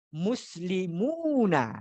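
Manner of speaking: very slow Muslimuna with each syllable stretched; teacher modelling Muslimuna slowly; drawn-out model word. Shown as a demonstration. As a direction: draw out Muslimuna for the class.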